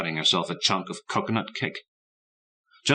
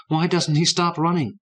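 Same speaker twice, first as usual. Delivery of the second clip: put-on boy's worried thought; 10 semitones higher, 4 dB louder than usual. A narrator's reading. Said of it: "thought Douglas".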